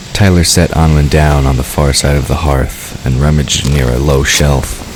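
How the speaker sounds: normal talking voice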